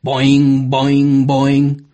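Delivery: monotone cartoon spring noise; drawn-out, even boing on one note; bouncing boings. Narrator mimics a puppet frog popping up, bouncing boings.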